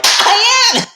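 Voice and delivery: very high, loud mock shriek; hammed-up crazed yell; manic shriek. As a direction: screech it gleefully. [name] admits insanity.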